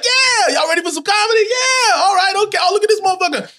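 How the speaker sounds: high-pitched stage shout; crowd hyping. Retold or imitated. Opener greets his fans at the show, hyped-up shout. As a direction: imitate a comic pumping up the room.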